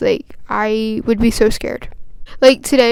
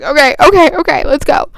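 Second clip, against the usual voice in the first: retold teary composure; 4 semitones higher, 6 dB louder than usual. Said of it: "wipe my tears up".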